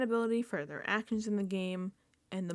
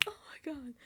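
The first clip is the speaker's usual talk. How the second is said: a gasp then a hushed oh my God; gasped whisper; 4 semitones higher, 13 dB quieter than usual. Real, not acted. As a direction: breathe it out in disbelief. Gasped reaction.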